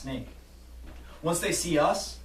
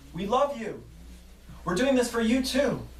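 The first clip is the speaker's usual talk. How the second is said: yelling back; 7 semitones higher than usual. Acted. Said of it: "yelling back".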